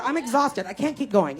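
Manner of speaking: ordinary delivery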